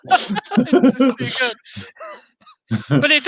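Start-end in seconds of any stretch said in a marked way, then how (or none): 0.0-1.6 slow words through real laughter; can barely get it out for laughing. Real, not acted. Co-host tickled by the drunk impression, laughing.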